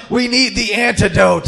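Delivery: loud, slow dramatic yell. Retold or imitated. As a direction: call it out urgently across the store, slowly. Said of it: "just yelled out".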